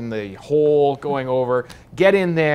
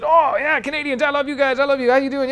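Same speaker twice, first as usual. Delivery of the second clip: much higher, eager and chummy general voice; excited Texan general; 14 semitones higher, 5 dB louder, faster than usual. Retold or imitated. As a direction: play the little Texan general, thrilled to meet them. Retold general from Texas talking.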